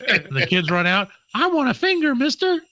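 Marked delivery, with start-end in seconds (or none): 1.3-2.6 put-on high little kid voice. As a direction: beg like a small child.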